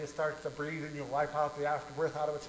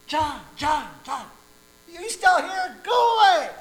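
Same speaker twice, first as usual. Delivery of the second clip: high, loud irritated shout; angry neighbor; 13 semitones higher, 16 dB louder, slower than usual. Acted. Neighbor yelling again at his caller, loud and angry. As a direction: yell down to send him off.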